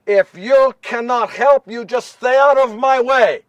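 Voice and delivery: loud, high, slowed-down scolding; acted exasperated wife laying down the law; stern wife. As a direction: order him out of your way, firmly. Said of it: "I had never seen Mary like this".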